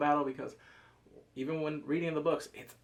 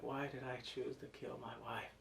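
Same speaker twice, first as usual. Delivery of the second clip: much softer, lightly voiced tormented king; hushed regret; 3 semitones lower, 15 dB quieter than usual. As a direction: murmur it as guilty self-reproach. Voicing the king's regret.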